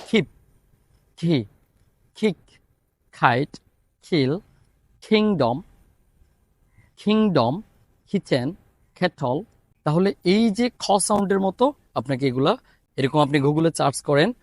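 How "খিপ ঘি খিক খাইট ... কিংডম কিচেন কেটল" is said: each K said like খ, as in কিংডম and কিচেন; aspirated K words. Shown as a demonstration. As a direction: puff the air out on every K. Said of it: "উদাহরণের ওয়ার্ডগুলো বলি".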